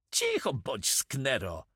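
usual speaking voice

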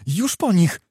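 piercing cry. A narrator's reading. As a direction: cry out the outcome sharply. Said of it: "krzyknął przeraźliwy Wołodyjowski".

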